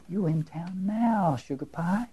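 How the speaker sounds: slow, tender and drawn out; rich low tones. A narrator's reading. The woman gently answering lost Nelson, slow and tender. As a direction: savor it like a kind stranger comforting a lost boy. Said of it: "who says in rich, low tones".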